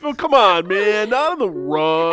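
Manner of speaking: high, loud, drawn-out whine of protest; hammed-up exasperated movie quote; mock protest. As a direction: plead in exaggerated dismay. Acting out protest over rug.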